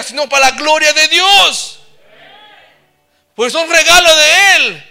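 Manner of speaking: loud, high, impassioned preaching; preaching at full force. Acted. Pastor exhorting the brothers and sisters, preaching at full force. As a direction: preach it out to the congregation with fire.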